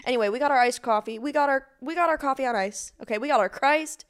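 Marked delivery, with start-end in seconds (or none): none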